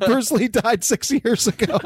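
high voice breaking into laughter; cracking up while talking; laughing. Real, not acted. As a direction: say it through a real laugh. Laughing at the absurd line.

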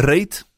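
"reet" said in Dutch with an ay vowel; Dutch pronunciation. Shown as a demonstration. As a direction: say "reet" to rhyme with "rate". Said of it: "slang for butt would be".

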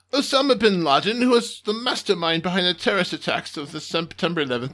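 usual speaking voice

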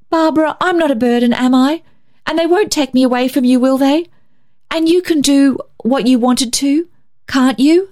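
put-on high, quick little-girl pleading; anxious child's cry. A narrator's reading. Little Muriel begs her sister for reassurance, anxious cry. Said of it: "Muriel gave a quick cry".

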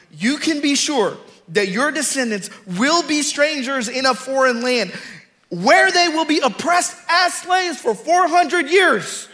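preacher's high, ringing scripture reading; full-force preaching. Real, not acted. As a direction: proclaim the verse with rising force. Declaims scripture while preaching.

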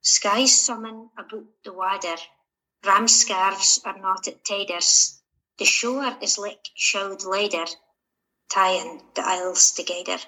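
poem read in Shetland dialect. Acted. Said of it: "in Shetland dialect".

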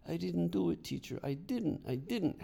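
put-on high pleading child's protest, a touch of tears; a boy insists he didn't do it, near tears; sobbing denial. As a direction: beg to be believed. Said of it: "Hector sobbed".

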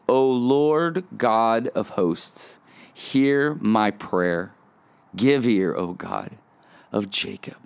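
slow, reverent reading with long pauses; scripture reading. Real, not acted. Reading a psalm to podcast listeners, slow and prayerful. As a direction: read it slowly, letting the voice settle down.

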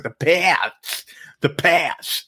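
Pats in a vague Boston-ish accent; odd accent attempt. Shown as a demonstration. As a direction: try Pats with a strange Boston twang. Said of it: "I don't even know what kind of accent that is".

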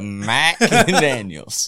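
"Mac Daniels" split in two, slow, laughing through it; mispronounced name. Retold or imitated. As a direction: say "Mac Daniels" with a separate "Mac", slowly. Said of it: "Did you mispronunciate my last name".

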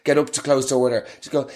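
normal talking voice

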